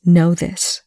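ordinary delivery, nothing marked

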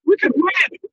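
high, thrilled gasp of hope; excited disbelief. Retold or imitated. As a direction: blurt it like the game just turned. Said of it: "and I was like".

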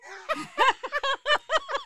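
squealing high laugh; husband laughing at the picture; high-pitched laugh. Real, not acted. Laughs at the photo.

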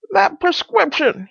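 high, slow character voice; distressed Pycraft. Acted. Pycraft blaming the remedy, high and aggrieved. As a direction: draw it out in high-pitched complaint.

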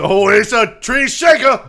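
high, slow Southern holler; put-on Southern accent. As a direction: shout it as a hammed-up Southerner. Repeated Southern catchphrase bit.

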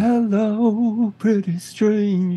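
lightly sung, high haunting croon; a hint of a soulful singer crooning; [name] voice. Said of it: "that haunting".

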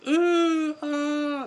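very high, loud porno-style moaning; hammed-up shrieky moans of horror-film passion; high-pitched mock moans. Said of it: "just slightly more high-pitched".

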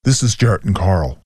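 lightly done serious TV-host voice; a hint of a dramatic ghost-hunter intro; investigative voice. A narrator's reading. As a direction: Jarrett recording his ghost-hunt intro. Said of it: "in his best investigative voice".

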